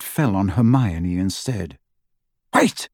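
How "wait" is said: a sharp high cry; snapping to stop them; sharp, cutting command. Acted. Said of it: "said Narcissa sharply".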